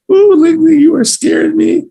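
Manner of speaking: high, loud playful squeal of fright; mock scared. Teacher squealing in mock fright at puppet. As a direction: squeal in pretend fear at the puppet.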